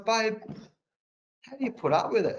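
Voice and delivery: a hint of his wife's gentle concern; quoting his wife. Wife quoted sympathizing.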